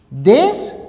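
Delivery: "Des" suelto, con tono ascendente y pausa detrás; corte de renglón. Shown as a demonstration. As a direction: deja "Des" en el aire, como fin de renglón. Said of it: "la forma correcta es esta". ("Des" aislado, subiendo la voz)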